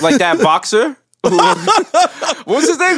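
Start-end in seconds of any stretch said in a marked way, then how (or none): 1.2-3.0 slow, high, through laughter; laughing. Real, not acted. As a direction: ask it while cracking up. Laughing while recalling.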